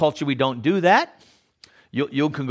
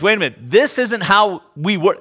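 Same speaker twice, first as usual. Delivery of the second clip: startled realization; 6 semitones higher, 4 dB louder than usual. Retold or imitated. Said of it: "she recognized".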